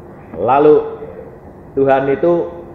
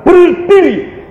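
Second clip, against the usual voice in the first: drawn-out, high commanding shout; perintah menggelegar; 13 semitones higher, 5 dB louder, much slower than usual. Retold or imitated. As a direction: bellow it slowly as God's command. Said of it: "dia berkata". (seruan memerintah, tinggi dan panjang)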